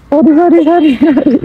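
sing-song the sorry up high to coax her; jokingly coaxing sorry, pitched high; high pleading apology. Real, not acted. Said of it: "माफ कर दे".

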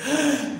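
sudden loud gasp; mock frightened gasp. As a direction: gasp as if scandalised. Mock fright at men in dresses. (grito ahogado de susto fingido)

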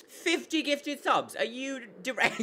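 high, incredulous, breaking into laughter; stunned disbelief. Real, not acted. Streamer reacts to fifty gifted subs, stunned disbelief. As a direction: squeak it out, astonished. Shocked by large gift.

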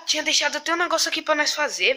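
ordinary talk, nothing marked

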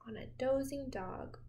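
ordinary delivery, nothing marked

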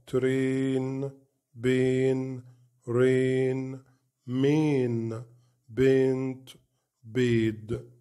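words like دي and وي with the long "ay" sound; long A drill. Shown as a demonstration. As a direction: read each long A word carefully. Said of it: "نفس الكلام مع ال اي اي". (قراءة كلمات A الطويلة)

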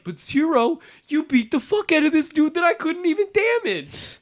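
strained, high mock whine; whiny disbelieving X. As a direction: plead with your hero in disbelief. X protesting to Zero.

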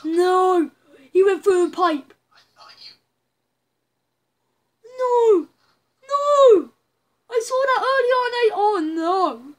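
loud, high cries of no; real horrified shouting; anguished no. Real, not acted. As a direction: yell no at the screen in horror. Horrified at Ben's fate in game.

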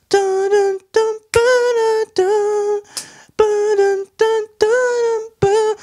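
high vocalized synth-and-drum riff; vocal beat imitation. Reviewer imitating the song's background beat, high vocalized riff. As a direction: hum the backing beat in high syllables. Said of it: "the stuff he's doing in the background with the boom".

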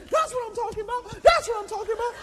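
high, fast cheering, laughing; trainer cheering. Retold or imitated. Trainer cheering the running pastor, high and laughing. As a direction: hype him up like a coach.

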